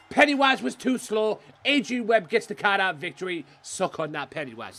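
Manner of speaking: gloating. Real, not acted. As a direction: crow over the win. Gloating over the win.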